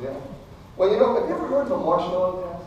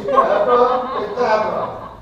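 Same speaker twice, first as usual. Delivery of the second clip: magic spell incantation; 4 semitones higher, 5 dB louder, much slower than usual. Acted. Magician casting a spell, laughing.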